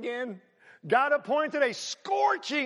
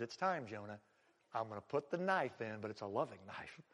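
softer, lower, gentle resolve; tender God; 9 semitones lower, 10 dB quieter than usual. Acted. God gently telling Jonah change is coming, tender. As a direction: speak like a loving father about to correct.

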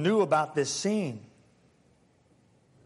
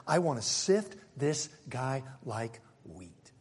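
slow menacing Satan; 6 semitones lower, 5 dB quieter, slower than usual. Acted. Satan's request voiced.

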